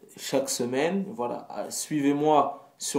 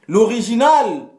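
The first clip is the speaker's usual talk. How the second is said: hyped shout; 7 semitones higher, 9 dB louder than usual. Acted. Self-hype climax.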